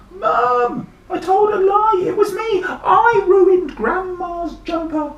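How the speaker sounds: put-on very high, loud boy's wail; desperate confession. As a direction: cry out the truth to mum. Boy confesses from monster's belly.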